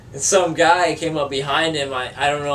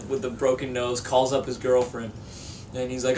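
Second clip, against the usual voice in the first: normal talking voice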